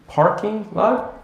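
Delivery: "parking lot" slow, with a crisp p; corrective clear p. Retold or imitated. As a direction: question "parking lot" slowly with a real p. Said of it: "they will say potato or barking lot".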